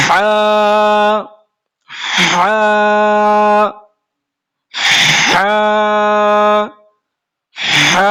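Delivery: হা pushed from the middle of the throat; deep throat ha. Shown as a demonstration. Teacher shows the throat letter, heavy throaty হা. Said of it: "এটা হবে গাঢ় করে উচ্চারণ".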